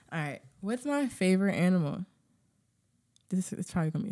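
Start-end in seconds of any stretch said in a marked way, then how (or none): none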